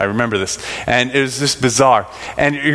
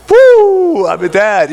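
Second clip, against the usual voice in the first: a high, loud cheer; bursting with pride at becoming a dad; joyful whoop; 7 semitones higher, 7 dB louder, much slower than usual. Retold or imitated. New father celebrating at night.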